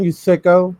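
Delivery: ordinary talk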